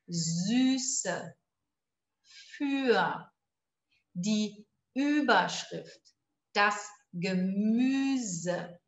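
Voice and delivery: slow, clear model words for repetition; slow drill. Shown as a demonstration. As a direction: read each word slowly for students to copy. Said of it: "De novo".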